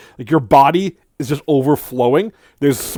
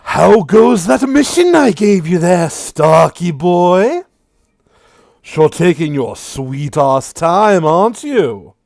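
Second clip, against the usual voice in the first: loud, high taunting villain; mocking devil; 5 semitones higher, 6 dB louder, slower than usual. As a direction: sneer at him with relish. GM voices Sirik, Lord of Lies.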